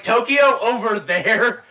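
high, loud, slowed-down question; acted questioning call. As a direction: ask slowly and loudly, like a lost tourist. Repeats his question to the conductor.

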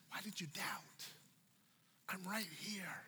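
softly whispered reassurance. Acted. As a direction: whisper it close, intimately. Intimate words of Jesus.

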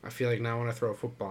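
usual speaking voice

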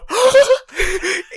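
a sharp, high, loud gasp in mock shock. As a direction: gasp as if scandalized, half laughing. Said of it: "in your shocked tone".

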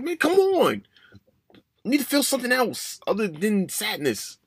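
high-pitched frustrated whine; desperate self-pleading. Retold or imitated. Pleading with himself while driving.

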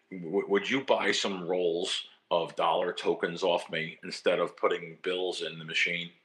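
low, slow retold dark voice. Stranger offers to sell him dollar tokens in a low, slow dark voice. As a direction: lean low and unhurried like the mysterious stranger. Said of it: "in this dark voice".

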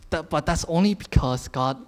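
usual speaking voice

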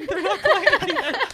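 laughing. Real, not acted.

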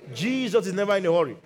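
usual speaking voice

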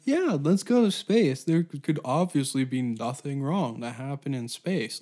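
over-keen, naive excitement; mock enthusiasm. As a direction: gush like a clueless enthusiast. Imagined naive space travellers.

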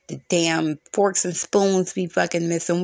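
ordinary talk

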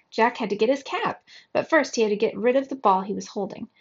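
plain reading voice

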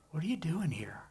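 God whispering to the frightened prophet; whispered question. Acted. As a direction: repeat it in a hushed whisper. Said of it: "the word that comes to you is this".

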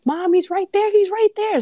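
put-on retelling a childhood scare, high and frantic; scared child yelling. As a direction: yell for mom like a terrified kid. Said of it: "I'll call her and yell".

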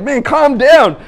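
urgent plea. Retold or imitated. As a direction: try to settle a panicking kid, loudly. Boy convulsing on the ground.

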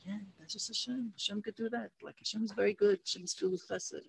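put-on high, soft, airy elderly voice; gentle dying sage; frail old rabbi. Sick Rav reassuring the father, frail and soft.

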